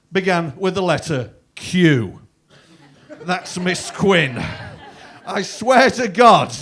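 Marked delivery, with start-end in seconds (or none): none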